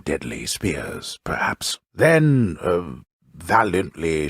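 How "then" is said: high, loud, drawn-out THEN; storyteller seizing on a new idea; dramatic improvised transition. A narrator's reading. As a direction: leap up in pitch and stretch it out. Narrator improvises yet another death.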